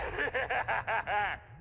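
a quick evil chuckle; gloating laugh. Acted. Plankton mocking Krabs, evil chuckle. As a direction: laugh like the formula's already yours. Villain gloats after claiming formula.

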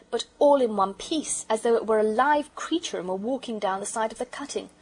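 ordinary delivery, nothing marked

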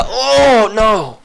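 high, loud cry of dismay; shocked cry. Real, not acted. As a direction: yelp as it all goes wrong. Character dies, cries out.

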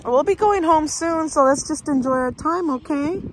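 high soothing voice. Real, not acted. Soothing child.

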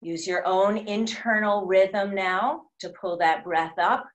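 ordinary talk, nothing marked

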